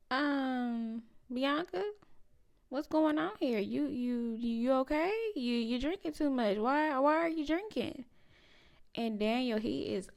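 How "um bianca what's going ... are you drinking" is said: higher, lightly voiced worried parent; a hint of a flustered mom finding her drinking; concerned parent. Parents walk in on Bianca drinking, lightly voiced worried and higher. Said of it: "they all walked in looking like".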